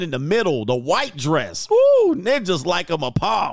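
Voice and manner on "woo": short, loud, very high woo; excited whoop. Real, not acted. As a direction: let out one piercing whoop. Reacts loudly to pictures.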